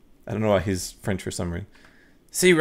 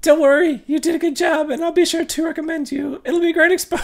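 much higher polite woman's voice; woman client; 4 dB louder than usual. Acted. Anna thanks the ghost hunter, much higher woman's voice. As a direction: play the relieved client, high and gracious.

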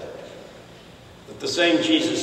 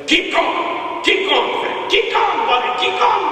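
high, rapid-fire urging; imitated congregation yell; slower than usual. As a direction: cheer the preacher on in a high yell. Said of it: "they stand up and yell".

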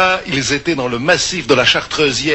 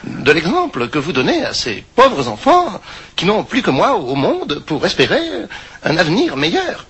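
put-on old grandma voice. Grandma scolds the men.

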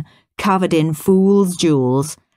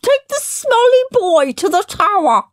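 mock Queen voice; 4 dB louder than usual. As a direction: sneer down at him as a snooty queen. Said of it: "said the Queen".

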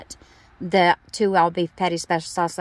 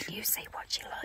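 whispering; 20 dB quieter than usual. Real, not acted. Whispering aside during call.

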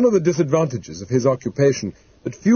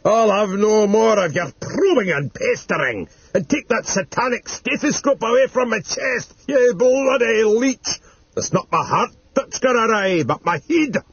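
furious yelling; 9 semitones higher than usual. Acted. Bedridden grandfather protesting at the throat mic, high furious yelling. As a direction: pitch it right up and rail at them. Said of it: "he yelled".

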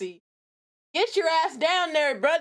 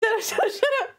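usual speaking voice

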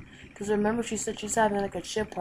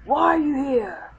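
usual speaking voice

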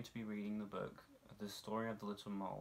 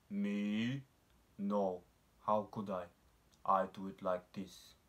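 louder, slow, lightly voiced cow; a hint of a placid cow denying it; cow answering; 6 dB louder, slower than usual. A narrator's reading. Cow answers the mole, louder calm lightly voiced denial. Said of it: "he asked the cow".

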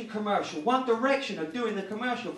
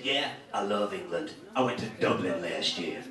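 dim American guy; 4 dB quieter than usual. Retold or imitated. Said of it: "He said".

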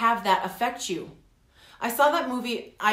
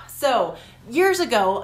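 usual speaking voice

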